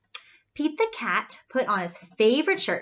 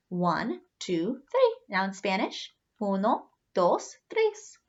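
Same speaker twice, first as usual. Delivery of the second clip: usual speaking voice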